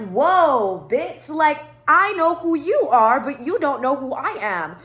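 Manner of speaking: high, slow, stretched-out retort; loud, incredulous put-in-your-place; indignant comeback. Retold or imitated. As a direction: set them straight with drawn-out emphasis.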